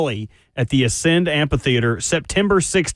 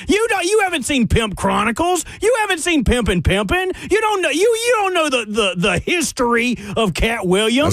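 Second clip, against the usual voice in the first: high-pitched, outraged disbelief; incredulous disbelief; 7 semitones higher than usual. Real, not acted. Co-host scolding colleague over comedy history, incredulous. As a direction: challenge them with voice pitched way up. Incredulous rant at co-host's ignorance.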